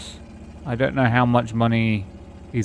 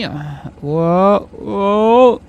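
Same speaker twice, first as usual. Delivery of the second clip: surprised; 8 semitones higher, 5 dB louder than usual. Real, not acted. Startled in game.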